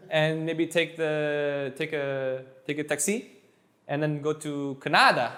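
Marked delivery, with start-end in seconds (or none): 2.8-3.3 taxi stressed on the last syllable, tak-SEE; deliberately wrong stress. Shown as a demonstration. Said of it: "It sounds weird". 4.8-5.3 Canada stressed on the middle syllable, ca-NA-da; Canada with the weight shifted to the middle; deliberately wrong stress. Shown as a demonstration. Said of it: "It sounds weird".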